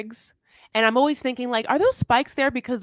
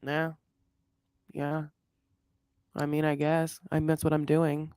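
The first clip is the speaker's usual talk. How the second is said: softer, lower, sulky and unwilling; reluctant kid; 4 semitones lower, 6 dB quieter than usual. Retold or imitated. Eric grudgingly answering Puppy, soft and sulky. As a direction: mumble it like a kid dragged to the dentist. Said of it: "like a fourth grader ... the dentist".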